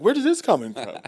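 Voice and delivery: high, loud incredulous question; mock astonishment. Friend reacting to a sudden accent switch, mock astonished. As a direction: act baffled by what you just heard.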